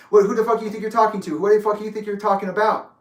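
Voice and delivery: retold furious challenge, fast and high; quick, loud indignant retort; matching his energy. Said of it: "That's the energy I gave".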